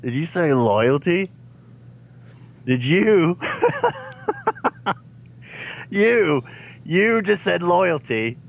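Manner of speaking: cracking up at the villain's hypocrisy; incredulous laughing disbelief. Real, not acted. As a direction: pitch jumps high, breaking into laughter. Player incredulous at villain's word.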